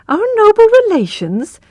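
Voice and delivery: high, incredulous echo of the words; can't believe what she heard; astonished. Acted. Tess echoing her brother in high disbelief. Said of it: "Tess astonished".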